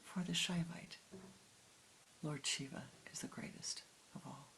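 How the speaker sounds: soft confiding whisper. Retold or imitated. Guru leans over to explain, soft whisper. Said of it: "he whispered".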